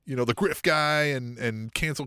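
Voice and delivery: ordinary talk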